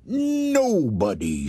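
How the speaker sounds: high, slow scornful sneer; sneering old woman. Acted. Wife sneering at the ghost, high slow sneer. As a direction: sneer it down at him. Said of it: "she sneered".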